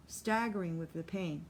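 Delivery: plain reading voice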